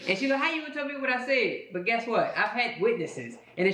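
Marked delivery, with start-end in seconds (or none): 0.4-1.6 higher, fast, laughing through the retort; giggling fake outrage; laughing mock indignation. Imitating wife's indignant retort.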